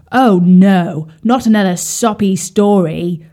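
loud, grumpy carp complaining. Acted. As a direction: grumble loudly like a grouch.

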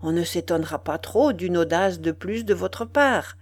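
ordinary delivery, nothing marked

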